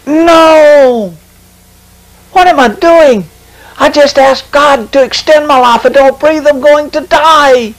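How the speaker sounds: very high-pitched cry of alarm; retold desperate scream. Preacher relives screaming in his spirit as realization hits, panicked. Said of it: "screamed as loud as I could".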